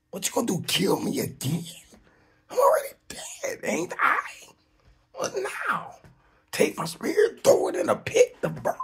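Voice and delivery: very high, loud challenge; defiant taunt. Acted. Dead man's spirit taunting the dark shadows, loudly defiant. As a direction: dare them to do their worst, voice way up.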